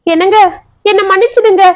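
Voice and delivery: understated acted wail; mildly wailing plea. A narrator's reading. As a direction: beg forgiveness, on the edge of tears. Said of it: "மீண்டும் கதறினாள்".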